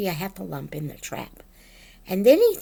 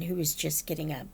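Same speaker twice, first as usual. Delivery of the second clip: ordinary delivery, nothing marked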